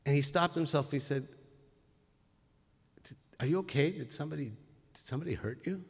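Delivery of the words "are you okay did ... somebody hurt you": much softer, caring question; gentle concern. Retold or imitated. Itamar gently asks the upset attendant if he is hurt, soft concern. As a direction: ask softly, as if to someone hurt.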